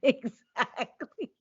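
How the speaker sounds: slow, laughing exactly; laughing agreement. Real, not acted. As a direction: stretch it out through a laugh.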